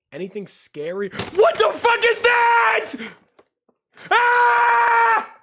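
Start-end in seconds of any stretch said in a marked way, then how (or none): 1.1-5.4 sudden shrieking jump-scare screams, much higher and louder; terrified scream. Real, not acted. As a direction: scream in real fright at the screen. Jump scare while watching video.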